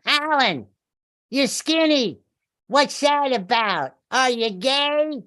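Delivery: high, cartoonish blurting woman, slow on the end; blurting therapist. Therapist blurting at Alan in first session, high cartoon voice. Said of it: "I'm gonna do an imitation of her voice".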